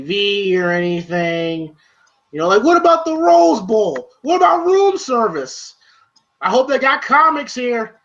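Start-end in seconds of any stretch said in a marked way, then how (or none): 2.3-8.0 much higher, complaining Paulie voice; hammed-up high griping Paulie; whiny Paulie. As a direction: whine like a spoiled guy stuck without TV. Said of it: "Paulie always complains".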